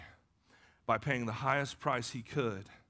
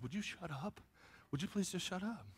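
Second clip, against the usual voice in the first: very soft imitation of an exasperated wife; hushed scolding; 6 semitones higher, 9 dB quieter than usual. Wife begging excited husband to shut up, hushed scolding. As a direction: plead for silence under your breath.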